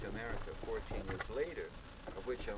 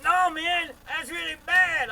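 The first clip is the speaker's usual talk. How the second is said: high, slow, drawn-out heckler's yell; imitated yelling; 17 dB louder, slower than usual. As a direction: holler it louder to drive it home.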